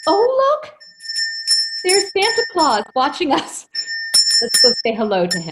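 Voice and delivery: high, loud sudden cheer; forced brightness. Acted. Housekeeper distracting the girl from her tears, forced loud cheer. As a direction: cover the tears with excitement.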